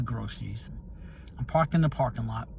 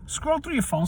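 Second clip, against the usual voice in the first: a high, detached voice inside his head; inner voice; 12 semitones higher than usual. Retold or imitated. The urge inside him telling him, in a high faraway voice. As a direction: voice the nudge from inside your head. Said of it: "something said to me".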